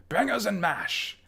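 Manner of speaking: high, slow exclamation in mock British; hammed-up British accent. Player showing off his best British accent, hammed up. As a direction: ham up a British accent, drawing it out. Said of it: "that's my best British accent".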